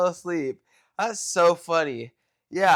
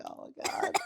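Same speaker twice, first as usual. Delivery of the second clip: whispered oh my God; whispered cringe; 9 dB quieter than usual. Retold or imitated. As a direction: breathe it out embarrassed. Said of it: "I just cringed".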